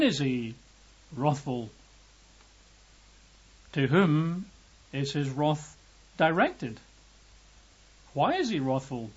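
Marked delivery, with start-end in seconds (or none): none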